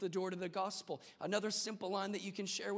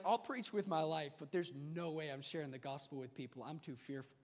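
fading mock fear; 4 semitones lower, 5 dB quieter than usual. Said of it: "you're like".

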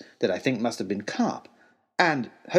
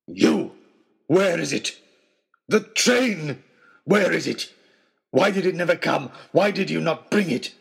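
loud, higher, accusing wild man; angry accusation; 5 semitones higher, 4 dB louder than usual. Acted. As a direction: hurl the accusation at him. Trapper accusing host.

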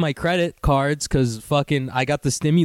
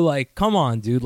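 normal talking voice

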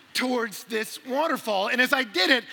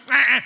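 loud imitated animal yelp; startled deer cry; 4 semitones higher, 12 dB louder than usual. Deer startled by a climbing hiker, yelping.

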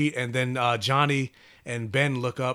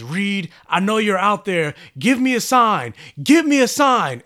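slow, rising desperate call, much higher and louder; 8 semitones higher, 9 dB louder than usual. Retold or imitated. Johnny calling to missing Reed, desperate rising shout. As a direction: shout up to the sky, pleading for a sign.

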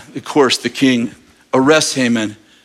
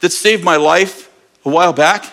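higher king voice; 6 semitones higher than usual. Retold or imitated. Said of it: "and he goes".

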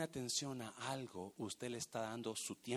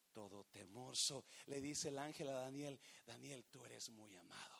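much softer, fading to a hush; tender hush; 3 semitones lower, 12 dB quieter than usual. Real, not acted. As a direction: draw them in close and gentle. Tender fading sermon climax.

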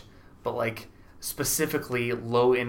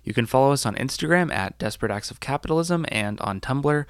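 upbeat announcer read; bright sign-off to listeners; podcast outro; 5 dB louder than usual. Acted.

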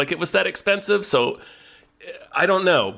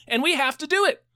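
much higher, squeaky promise; high squeak; 14 semitones higher than usual. Acted. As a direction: squeak it out in mock dread. High promo exaggeration.

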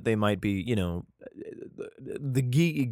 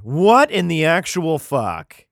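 loud slow disbelief; 5 semitones higher, 9 dB louder, slower than usual. Real, not acted. Disbelief at David fleeing.

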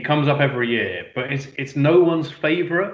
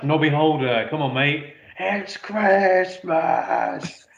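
drawn-out rocker cry of Christmas; [name] impression; 3 semitones higher, slower than usual. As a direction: belt it like a glam rock singer. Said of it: "doesn't mean I'm like".

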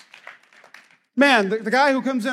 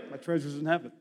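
low, soft and humble; soft reply; 7 semitones lower, 10 dB quieter, faster than usual. Retold or imitated. Church member asked why they come early, answering softly. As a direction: say it quietly, almost to yourself.

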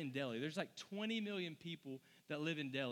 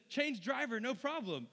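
cheery driver, a bit higher and louder; grinning driver; 6 semitones higher, 4 dB louder than usual. Retold or imitated. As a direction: say it with a big grin. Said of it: "even a bigger smile".

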